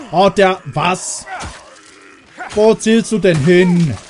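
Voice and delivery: complaining loudly at a teammate who keeps missing; frustrated outburst. Real, not acted. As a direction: fling the complaint at a clumsy ally. Frustrated at ally's bad aim.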